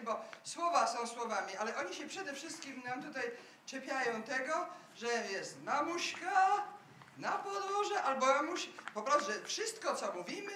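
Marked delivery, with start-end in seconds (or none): 5.0-8.0 long drawn-out stressed syllables, slow; Kraków drawl. Shown as a demonstration. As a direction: drag the vowels like an old Kraków courtyard. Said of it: "w sposób właśnie taki przeciągliwy".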